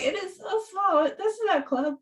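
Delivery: put-on country girl panicking in a tiny club, voice pitched high; panicked disbelief. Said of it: "I'm like".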